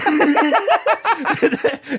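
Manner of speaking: high, loud burst of laughing; loud cackle; real laughter. Real, not acted. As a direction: crack up at your own joke. Cracks up after joke line.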